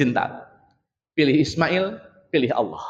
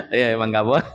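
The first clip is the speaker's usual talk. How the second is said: chuckling as you say it again; laughing; 5 semitones lower than usual. Real, not acted. Preacher repeating the answer to the audience, laughing. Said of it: "repeats answer laughing".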